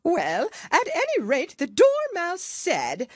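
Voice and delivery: high, loud tearful plea; whimpering Hatter. Acted. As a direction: whine it out on the edge of tears. Hatter voiced.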